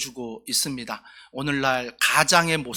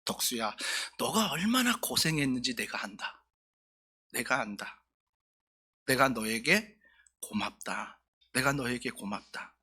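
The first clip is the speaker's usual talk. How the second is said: tender father's voice; 4 semitones lower, 8 dB quieter than usual. Retold or imitated. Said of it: "아버지가 이렇게 말합니다".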